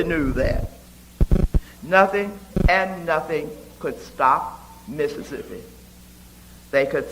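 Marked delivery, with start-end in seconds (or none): none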